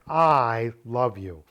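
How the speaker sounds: long, loud "I" leading the phrase; stress on I. Shown as a demonstration. Teacher repeating the subject-emphasis example, stressed "I". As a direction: lean hard on "I", slow and deliberate. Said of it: "emphasizes the subject".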